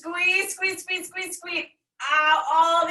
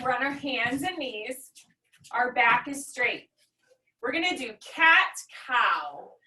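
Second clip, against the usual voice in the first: normal talking voice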